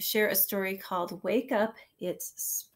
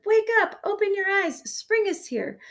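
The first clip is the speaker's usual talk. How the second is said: high, loud excited shout; 12 semitones higher, 7 dB louder than usual. A narrator's reading. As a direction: shout it to wake everyone.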